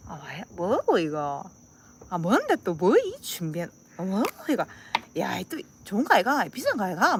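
blunt, swinging Gyeongsang intonation; Gyeongsang dialect. Acted. Receiving a gift, fussing in thick Gyeongsang dialect. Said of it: "진짜 찐사투리였다".

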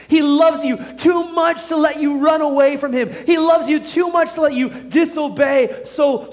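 high, loud, insistent sermon voice; full-force preaching. Real, not acted. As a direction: hammer each warning home to the congregation.

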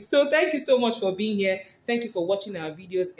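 ordinary delivery

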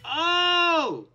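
high, loud, drawn-out oh; dismayed cry. Pretend. Player watching horses get hit, dismayed cry. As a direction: wail in mock horror.

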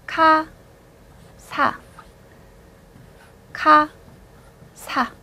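카 and 사 said apart, each alone; separate syllables. Shown as a demonstration. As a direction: split 카 from 사 with pauses. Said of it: "착각하시는 경우". (카, 사를 따로 끊어서)

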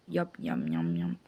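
soft mock chewing sounds; munch on the sweet quietly; eating noises. Tasting the doctor's dessert, soft mock chewing.

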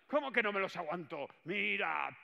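high, loud indignant shout; defiant shout. Acted. Boss demanding his staff watch, high loud indignant shout. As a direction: yell the challenge.

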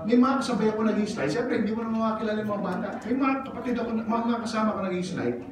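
ordinary delivery

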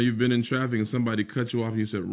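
normal talking voice

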